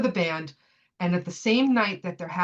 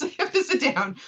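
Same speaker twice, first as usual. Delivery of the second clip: laughing; 7 semitones higher than usual. Retold or imitated. Aunt overwhelmed, retold with laugh.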